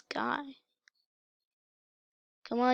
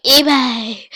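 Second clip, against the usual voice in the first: sudden loud exclamation; mock cheer; 13 dB louder than usual. Vlogger joking about selling merch, loud mock cheer. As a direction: burst out loudly, as if announcing it. Mock shout after "just like.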